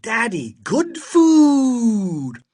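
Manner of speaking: loud, high cry with "food" stretched long; gleeful high holler; excited child shouting. A narrator's reading. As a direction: proudly announce the find to Daddy. Child shows off junk food.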